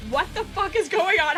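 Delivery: normal talking voice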